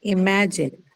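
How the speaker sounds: Imagine with weight on middle MA; stress on ma. Shown as a demonstration. Teacher prompts the student with Imagine, stressing MA. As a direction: lean on MA in Imagine, then fall. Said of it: "ma is the one that will take the stress".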